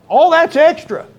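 loud and high, flung out to the congregation; preacher's outburst. Real, not acted. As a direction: toss it away with a sudden burst of fervor. Sermon climax, sudden outburst.

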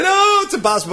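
put-on high, whiny child complaint; whiny kid. Mimicking kids who complain mid-task, high whine. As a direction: play the protesting kid. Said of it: "So I'm like".

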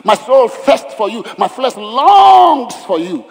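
high, loud, slow longing cry; fervent psalm. Acted. David crying out his longing to God, high and slow. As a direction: stretch out the yearning. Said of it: "David said".

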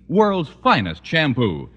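ordinary talk, nothing marked

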